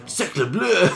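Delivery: high, laughing mock French exclaim; French accent. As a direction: ham up a cartoon Frenchman. Laughing, hamming French words.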